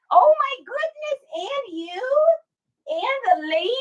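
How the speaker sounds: high, cooing pet voice. Real, not acted. Welcoming both dogs to the stream, high cooing baby talk. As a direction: gush over the dogs. Greeting her second dog.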